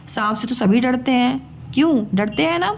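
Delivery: ordinary delivery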